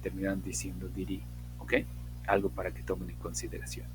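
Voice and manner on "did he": "Did he" fused into "diri", h dropped; reduced "Did he", no h in "he"; fast-speech "Did he". Shown as a demonstration. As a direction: run "Did he" together for fluency. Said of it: "premiar la fluidez, terminan diciendo".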